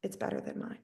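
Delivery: envious whisper under the breath. Retold or imitated. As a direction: whisper it as a jealous admission. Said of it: "was like".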